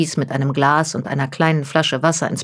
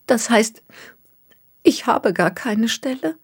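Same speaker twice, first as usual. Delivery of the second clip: fighting tears; 7 semitones higher than usual. Acted. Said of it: "kämpfte sichtlich mit den Tränen".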